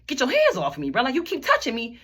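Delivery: imitated upset protest, building to a high pitch; retold screaming protest. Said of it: "Now I'm really screaming".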